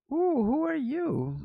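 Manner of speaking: high, slow, drawn-out coo; mock-flirty high female voice; smitten woman caller. Women callers taken with his voice, imitated high coo. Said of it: "They'd be like".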